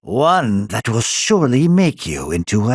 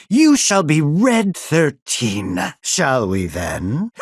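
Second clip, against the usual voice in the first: ordinary delivery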